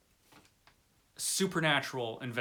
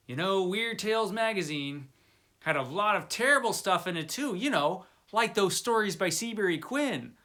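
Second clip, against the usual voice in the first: a lightly voiced sniffy critic, pitched higher and louder; mock snooty critic; 6 semitones higher, 4 dB louder than usual. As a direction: imitate a dismissive critic, a touch higher and louder. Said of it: "critics, you'll hear them say things like".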